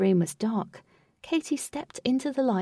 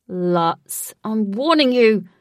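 angry warning; 9 dB louder, slower than usual. Acted. Wraith threatens Lutz.

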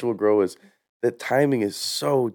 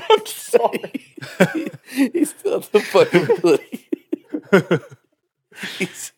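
high, helpless giggling; can barely speak for laughing; laughing through words; 10 semitones higher, much slower than usual. Real, not acted. Laughing fit.

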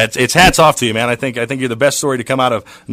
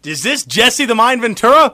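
a hint of old-time radio announcer, higher and slow; 1920s sportscaster voice; 7 semitones higher, slower than usual. Prank caller to Jesse Ventura, lightly vintage sportscaster. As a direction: put on a mild vintage sportscaster voice. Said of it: "Why did I sound like a nineteen twenties, uh, sportscaster".

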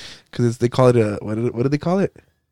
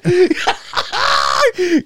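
high-pitched burst of laughter; shrieking laugh; 12 semitones higher than usual. Real, not acted. Cracking up at a friend's pale face, high shrieking laugh.

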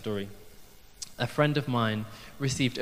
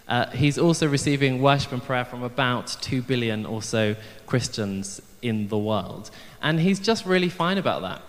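normal talking voice

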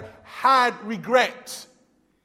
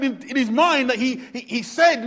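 ordinary talk, nothing marked

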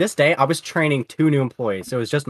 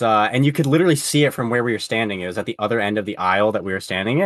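usual speaking voice

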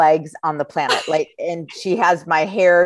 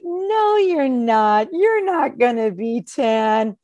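put-on kids protesting; 7 semitones higher, much slower than usual. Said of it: "then they all are like".

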